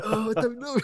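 high giggly I don't know; laughing reply. Retold or imitated. Answering Nick mid-arrest, high laughing voice. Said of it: "And I was like".

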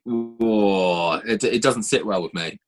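normal talking voice

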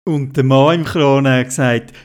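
ordinary delivery, nothing marked

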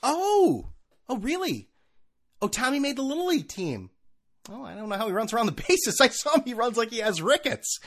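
high gushing mock parent voice; mock bragging parent. Mocking a bragging Facebook parent. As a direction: gush about the kids like a proud parent.

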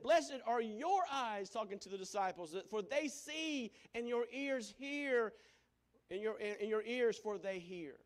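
preacher stressing the blessing on the disciples; emphatic blessing. Acted. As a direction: lean into every word.

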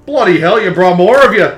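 high, loud, exasperated outburst; coffin's exclamation. Acted. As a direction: exclaim loudly as a fed-up construct.

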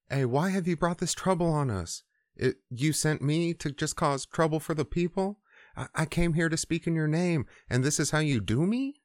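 high, whiny mock protest; whiny complaint. Moses griping to God about the trouble, high mock whine.

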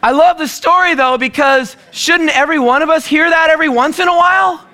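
high, urgent preaching voice with a rising challenge; impassioned preaching. Acted. Pastor stirring his church, urgent high preaching. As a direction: press the congregation hard, voice lifted high.

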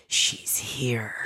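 hushed unvoiced whisper. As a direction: whisper it secretly. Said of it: "He's like".

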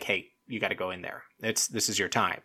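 hushed, low sideline nudge; coach's quiet urging. Retold or imitated. Producer sending a contestant in, quiet coach-like urging. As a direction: imitate a coach softly prompting a player. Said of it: "like, the coach, like, telling the kid".

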